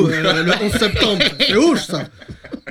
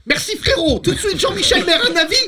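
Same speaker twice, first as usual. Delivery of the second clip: mock rowdy TV panel host; 12 semitones higher than usual. Host mocking a noisy Hanouna-style panel, high hyped shout. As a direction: ham up a brash talk-show host pushing his guest. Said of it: "je te le fais".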